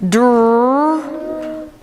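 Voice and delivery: Dr-unk said in stretched parts, the onset held apart; stretched word. Shown as a demonstration. Teacher stretching a word for the class to blend. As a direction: stretch Dr-unk slowly sound by sound. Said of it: "we're gonna stretch these words".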